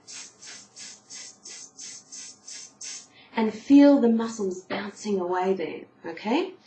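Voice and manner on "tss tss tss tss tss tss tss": short unvoiced "tss" pulses from the belly; pulsed tss hisses. Shown as a demonstration. Singing teacher demonstrating breath pulses, unvoiced hisses. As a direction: bounce the belly on each hiss. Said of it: "and say".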